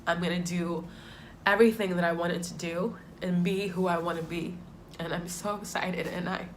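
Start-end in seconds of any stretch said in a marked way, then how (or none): none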